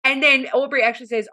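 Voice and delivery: normal talking voice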